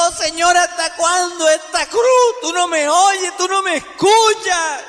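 loud, high-pitched cry of complaint to God; grito de clamor. Acted. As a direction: shout the plea, pitched high and held to the end. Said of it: "Y gritó". (clamor a gritos, agudo y desesperado)

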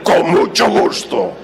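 slow, loud, mocking "con mucho gusto"; sarcastic repeat of the fake yes; sarcastic echo. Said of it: "Nunca dijo una verdad tan grande".

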